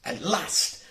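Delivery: ordinary delivery, nothing marked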